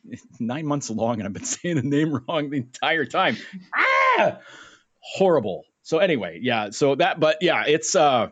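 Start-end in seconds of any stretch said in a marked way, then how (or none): 3.7-4.4 high, loud yelp of despair; anguished cry. Real, not acted. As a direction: cry out in mock agony. Anguish over his mistake.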